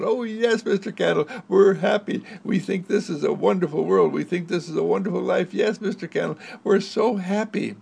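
put-on children cheering to the candle; lightly voiced joyful kids; happy children.

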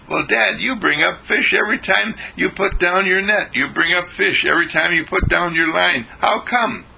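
put-on high-pitched boyish wonder; amazed sons. Boys asking their father how he catches fish, high amazed voice. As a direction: marvel up at Dad.

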